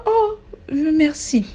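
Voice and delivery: drawn-out high, coy thank you. Retold or imitated. Complimented by a man, answering in a slow, high coy voice. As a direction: act flattered and a little shy.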